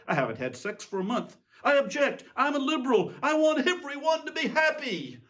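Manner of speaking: building from a complaint to a high, loud wail; rising indignant outburst. Acted. The ruler protesting to God, building to a loud whine. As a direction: work yourself up into a petulant tantrum.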